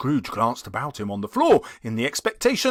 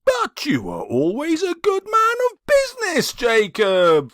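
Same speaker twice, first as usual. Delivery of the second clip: much higher, hesitant voice; faltering; 13 semitones higher, 4 dB louder than usual. A narrator's reading. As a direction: Scrooge falters, half-hoping. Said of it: "faltered Scrooge".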